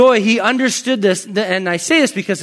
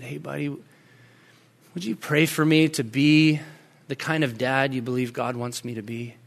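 soft, low, humble appeal; retold dad quietly asking his child; tender request; 5 semitones lower, 7 dB quieter than usual. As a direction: ask gently, unhurried and sincere. Retold dad kneeling, asking son.

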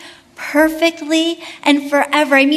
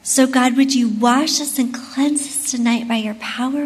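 hushed, reverent closing prayer; 3 semitones lower than usual. Acted. Closing prayer before the talk.

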